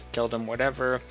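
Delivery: ordinary delivery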